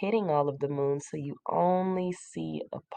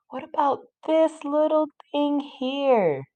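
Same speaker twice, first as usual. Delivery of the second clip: much higher, louder mock-excited question; playful discovery; 10 semitones higher, 5 dB louder than usual. Teacher asks class about a small find, playful discovery. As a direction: pitch it way up, as if you just spotted it.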